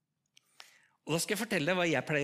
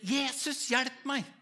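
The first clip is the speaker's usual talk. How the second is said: cry for help; 6 semitones higher, slower than usual. Retold or imitated. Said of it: "Så jeg ropte".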